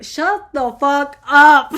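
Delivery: slow, loud, dragged-out shut-up; blunt yell. Retold or imitated. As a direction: shut him down hard and slow. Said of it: "I'm like".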